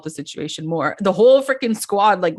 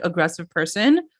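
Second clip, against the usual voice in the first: ordinary delivery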